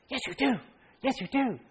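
high-pitched imitation of an insistent little boy; child's insistence. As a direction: pipe up like a kid contradicting someone. Voicing young son correcting a player.

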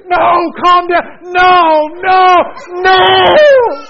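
high, drawn-out frantic shouting; reenacted full-volume panic; screaming. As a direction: shriek it slowly at crying children. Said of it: "she hears me screaming".

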